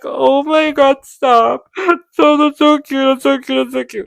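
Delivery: gushing squeal. Retold or imitated. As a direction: melt over how cute it is. Gushing over a tender scene.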